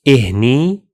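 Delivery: إهني repeated as "ih-ni", rising at the end; Arabic demo word. Shown as a demonstration. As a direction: model إهني again as "ih-ni". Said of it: "like this".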